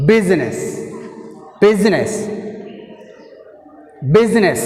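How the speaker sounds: Business with BUSI forceful, -ness falling; first-syllable stress. Shown as a demonstration. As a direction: punch the B of Business and let it fall. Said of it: "B, first syllable".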